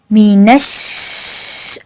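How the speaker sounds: मी नश with the श held long; held doubled sh. Shown as a demonstration. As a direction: pause on the श of नश. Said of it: "शद पे हम time देंगे". (शद पर रुककर मी नश)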